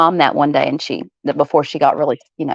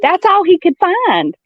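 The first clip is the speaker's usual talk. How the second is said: exasperated explaining; 12 semitones higher than usual. Retold or imitated. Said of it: "And I said".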